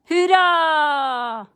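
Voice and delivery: high loud drawn-out hurra; a big hurra for the dog; cheer. Real, not acted. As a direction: cheer the word out long.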